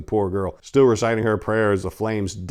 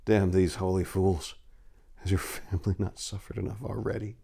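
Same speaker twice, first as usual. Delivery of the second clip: muttered; 7 dB quieter than usual. A narrator's reading. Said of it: "Remius muttered".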